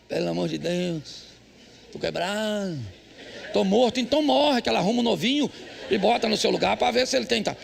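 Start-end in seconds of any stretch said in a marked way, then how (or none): 0.1-4.0 soft, slow worn-out groan; husband complaining to his wife, mock exhausted. Tired husband voiced.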